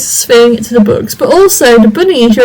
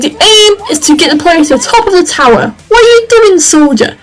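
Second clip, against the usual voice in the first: higher, sharp mock-furious scolding; angry sergeant; 7 semitones higher than usual. Calhoun yelling at Ralph to kill the bugs, higher mock fury. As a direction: tear into the soldier for running around. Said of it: "Calhoun is getting pretty angry".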